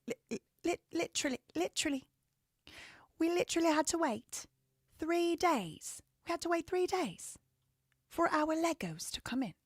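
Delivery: high-pitched put-on British voice; hammed-up high British accent; mock British accent. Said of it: "How they say?".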